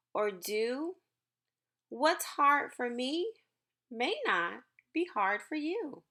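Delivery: gentle, measured picture-book reading; reading to children. Acted. Teacher reading a book to her class, gentle storytime voice. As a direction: read each line slowly to the class.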